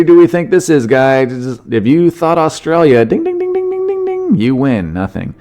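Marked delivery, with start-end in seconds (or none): 3.0-4.3 very high, rapid dinging; imitated winner bell. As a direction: mimic a game-show bell up high. Mimics a quiz winner bell.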